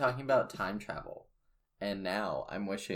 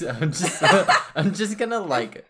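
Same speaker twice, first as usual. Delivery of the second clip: laughing; 8 semitones higher, 12 dB louder than usual. Real, not acted. Laughing at the knees joke.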